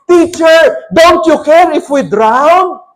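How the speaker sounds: high, loud, slow desperate cry; panicked plea. A narrator's reading. Disciples waking Jesus in the storm, high panicked plea. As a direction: plead as if the boat is sinking. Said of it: "ang sabi ng mga disciples".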